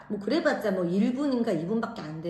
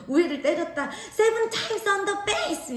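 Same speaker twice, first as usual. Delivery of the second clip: high, indignant protest; retold outraged complaint; angry accusation; 10 semitones higher, much slower than usual. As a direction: hammer out the accusation angrily, very high. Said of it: "너무 화가 나서".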